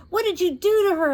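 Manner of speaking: high, loud demanding cry. A narrator's reading. Josie demands answers from the witch. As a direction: confront the witch, near tears. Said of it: "I demanded".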